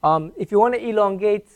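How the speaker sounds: ordinary talk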